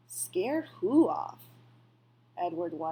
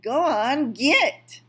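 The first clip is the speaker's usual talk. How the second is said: loud, high shout to shoo a bird; 4 semitones higher, 9 dB louder, slower than usual. A narrator's reading. Boy shouts the crow off the rabbit, loud and high.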